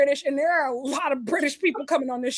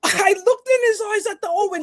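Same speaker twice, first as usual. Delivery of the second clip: higher, dramatic British wrestler line; mock British promo; 5 semitones higher than usual. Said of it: "Ospreay and Swerve start scrapping".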